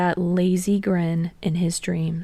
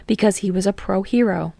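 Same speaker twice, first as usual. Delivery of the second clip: ordinary delivery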